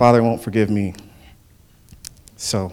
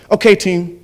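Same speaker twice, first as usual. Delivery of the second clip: higher-pitched coach addressing the team; coach impression; 7 semitones higher, 4 dB louder than usual. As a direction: imitate a coach with pouted lips. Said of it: "he'd stick his lips out like this".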